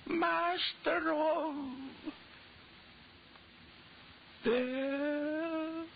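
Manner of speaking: high, slow, crying lament; slow weeping cry of a dying master; anguished sobbing. Acted. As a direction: plead with death, breaking down. Dying man's final anguish.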